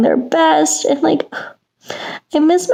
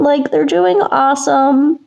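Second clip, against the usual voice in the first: ordinary delivery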